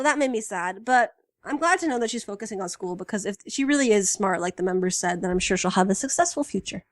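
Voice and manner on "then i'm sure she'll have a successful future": ordinary delivery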